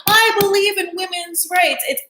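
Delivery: high-pitched, overblown righteous whining; put-on whiny outrage; whiny mock indignation. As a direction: whine it like an offended campaigner. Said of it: "it just comes off so whiny".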